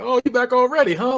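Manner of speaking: imitating gang members antagonizing someone; taunting. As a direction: needle him like a cocky bully. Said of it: "They antagonize him".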